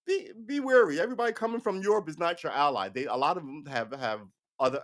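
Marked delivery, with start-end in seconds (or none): 0.1-2.9 high, urgent caution; acted-out alert to a friend; warning. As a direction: raise the pitch and press the warning home.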